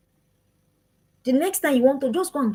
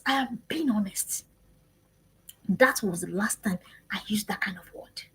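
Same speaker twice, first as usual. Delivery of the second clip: confessional whisper; 3 semitones lower, 6 dB quieter than usual. Real, not acted. Confessing quietly to viewers.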